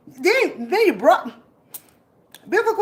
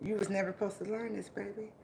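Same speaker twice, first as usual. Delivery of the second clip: ordinary delivery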